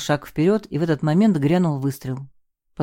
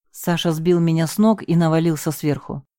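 plain reading voice

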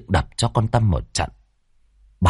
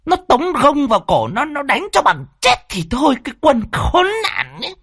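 high-pitched angry tirade; mother's furious, shrieky scolding; hissed fury; 12 semitones higher than usual. Acted. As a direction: demand and threaten in a strained high voice. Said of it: "bà lại rít lên".

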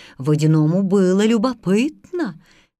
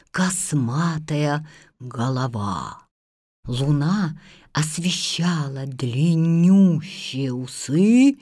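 ominous narration; 7 semitones lower, slower than usual. A narrator's reading. Slow low narration of the monster.

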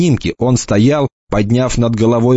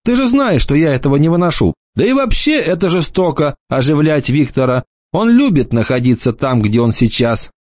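a hint of a teasing young lad, pitched up; light mocking boy's voice; lightly voiced cocky boy; 7 semitones higher than usual. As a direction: toss it off like a smug teenager. Enoch's voice.

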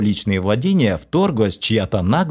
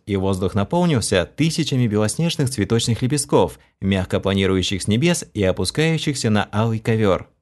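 plain reading voice

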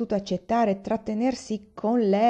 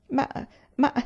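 stammering; 7 semitones higher than usual. A narrator's reading. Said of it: "balbettò Aiwan".

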